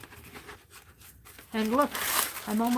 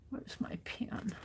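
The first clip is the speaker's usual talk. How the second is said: whispered to herself; 10 dB quieter, slower than usual. Real, not acted. Searching quietly while crafting.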